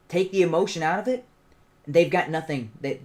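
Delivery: normal talking voice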